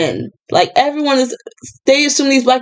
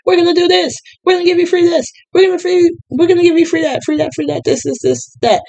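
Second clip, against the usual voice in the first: high-pitched mock campaign promises; pandering politician; 9 semitones higher, faster than usual. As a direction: reel off promises in a mocking politician voice. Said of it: "what do they do? They say".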